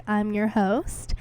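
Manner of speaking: ordinary delivery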